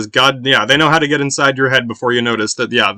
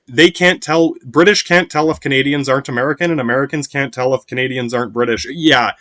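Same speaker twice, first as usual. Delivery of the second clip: normal talking voice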